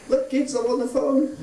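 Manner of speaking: high, bright pretend phone call; mock phone voice. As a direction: play the dad faking a call on a banana. Parent mock phone on banana.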